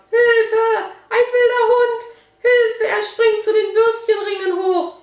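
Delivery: high, loud panicked cry; loud scream for help. A narrator's reading. Shop woman cries out about a wild dog, screaming. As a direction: shriek for help at full alarm. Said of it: "Da hörten sie einen lauten Schrei".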